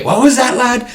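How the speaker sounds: high, loud demanding captain; captain demanding the boy repeat it, loud; challenging captain. Pretend.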